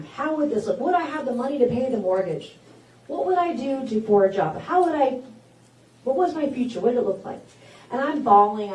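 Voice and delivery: usual speaking voice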